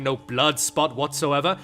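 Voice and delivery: ordinary delivery, nothing marked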